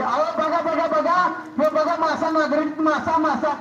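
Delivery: high, eager call to come and look; excited calling out. Acted. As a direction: call him over, thrilled at the find. Wife calls husband to see fish.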